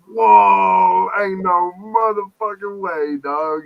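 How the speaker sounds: big loud whoa, then a slow drawn-out no way; floored by how old his friend is; stunned disbelief. Retold or imitated. Telling his friend he can't be that old, loud disbelief. Shocked at friend's real age.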